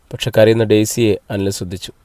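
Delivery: ordinary delivery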